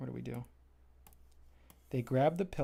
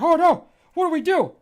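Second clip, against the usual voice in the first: much higher, loud panicked cry; panicked shriek; 13 dB louder than usual. Acted. Pillows seeing Jobbot coming, high loud panic. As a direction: shriek in terror at the killer bot.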